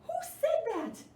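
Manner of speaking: high, loud startled gasp of a question; startled author. Acted. Author hears a voice interrupting his bearless story, startled and high. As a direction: jump as if someone spoke out of nowhere. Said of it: "looked around the room".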